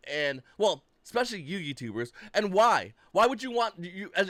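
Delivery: normal talking voice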